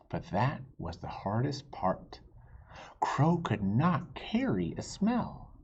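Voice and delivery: lively, expressive read-aloud; reading to children. A narrator's reading. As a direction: tell it like a picture book to kids. Reads story to children.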